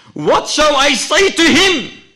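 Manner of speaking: loud, very high desperate cry. Acted. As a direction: shout the question at full voice. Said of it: "His brother cries out".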